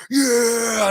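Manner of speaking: high, loud whoop; imitated crowd cheer. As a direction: cheer like the whole boat erupting. Retold cheer of whole boat.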